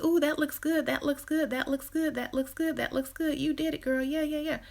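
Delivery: high, gushing flattery; fawning entourage gushing over an ugly outfit, high and eager; gushing yes-man. Acted. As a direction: hype the friend up like a fawning entourage.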